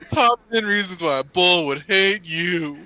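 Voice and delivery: loud, slow announcer voice; clickbait video narrator. Pretend. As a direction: hype it like a clickbait ad. Parodying clickbait video titles.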